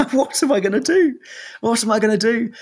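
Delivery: high, loud rising panic; a frantic cry of alarm; high-pitched, desperate panic; panicked. Retold or imitated. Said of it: "And I was thinking".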